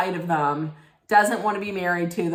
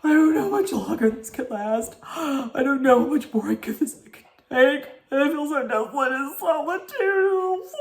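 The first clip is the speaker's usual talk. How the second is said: high, hammed-up weepy wail with gasps; mocking a housewife's sobbing confessional; 6 semitones higher than usual. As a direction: parody her tearful meltdown, gasping between lines. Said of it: "sobbing in the camera".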